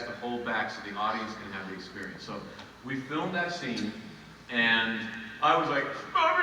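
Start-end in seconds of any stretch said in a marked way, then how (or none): 6.1-6.4 high, hammed-up wail of a name; mock emotional cry. Actor imitates his overwrought take, wailing a name. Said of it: "So emotional".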